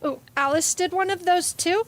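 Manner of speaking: ordinary talk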